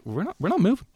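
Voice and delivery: high, smug stubborn walker; stubborn mock voice. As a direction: play the smug couple blocking the path. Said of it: "they're like".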